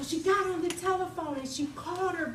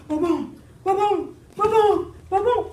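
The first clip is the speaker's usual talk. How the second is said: pounding heartbeat; 4 semitones higher, 9 dB louder than usual. Acted. Heart beating sound effect.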